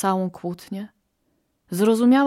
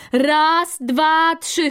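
raised, higher count to three; count-off call; 7 semitones higher, 4 dB louder, much slower than usual. Acted. Quail readying the flock to move, called-out count. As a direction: call out the count to rally the others.

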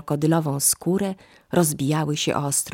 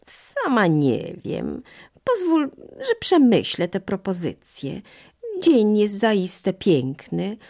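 higher, slow, musing monkey voice; hesitant monkey; 5 semitones higher, slower than usual. Acted. Monkey tempted by the fruit, high and hesitant. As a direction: think it over aloud, up high and unhurried.

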